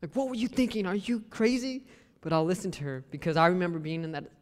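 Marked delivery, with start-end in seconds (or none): 0.0-1.8 higher-pitched exasperated outburst; incredulous, pitched-up mock anger; mad. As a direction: act out wanting to blow up at a sister. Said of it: "I really wanna get mad".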